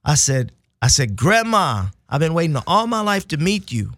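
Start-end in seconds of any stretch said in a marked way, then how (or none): 1.1-3.9 high, bright rush of love to grandma; eager greeting. Retold or imitated. Grandson greeting his grandmother for the first time, eager and high. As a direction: burst out with longing to meet her.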